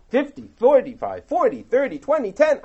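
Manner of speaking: urgent bargaining. Retold or imitated. Abraham haggling with God over Sodom, rising urgency.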